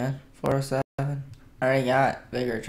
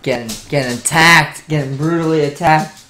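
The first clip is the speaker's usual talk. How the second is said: loud mock complaint; 9 dB louder than usual. Real, not acted. Pet attacks him.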